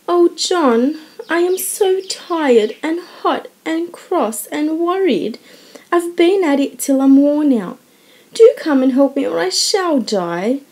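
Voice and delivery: put-on frazzled young wife begging her husband for help; worn-out wail. A narrator's reading. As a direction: plead like you're at the end of your rope. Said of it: "the exhausted housewife".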